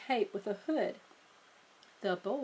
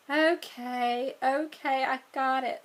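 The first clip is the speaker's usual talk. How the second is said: okay okay, brushing him off; impatient; 3 semitones higher, 3 dB louder, slower than usual. Acted. Sister cutting off her brother's reading, mildly impatient. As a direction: stop the lecture.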